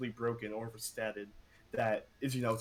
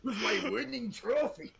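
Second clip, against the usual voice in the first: put-on proud German hero voice in a German accent; 10 semitones higher than usual. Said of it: "I can do a German voice if you want".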